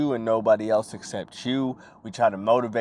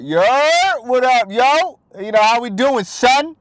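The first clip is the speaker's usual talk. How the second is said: high, loud New York street greeting; New York accent, loud and high; 11 semitones higher, 7 dB louder than usual. Retold or imitated. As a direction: hype New York homie voice, pitched up. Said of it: "anytime that you talk about New York".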